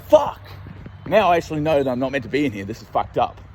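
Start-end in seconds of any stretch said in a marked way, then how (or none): 0.0-0.4 sudden high, loud curse; mock dismay. Realising he's trespassing, a loud mock curse. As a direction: blurt it like you've been caught. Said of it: "I was like".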